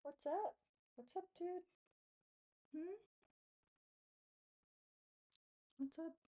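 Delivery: high pet voice asking the cat what's up; greeting the cat. Real, not acted.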